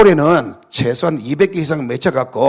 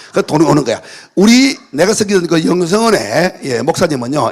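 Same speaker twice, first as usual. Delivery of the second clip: ordinary talk, nothing marked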